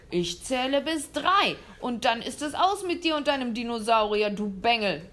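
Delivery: loud, menacing threat; a hunter yelling a countdown threat; threatening. Acted. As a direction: threaten the boy loudly. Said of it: "drohte der Jäger".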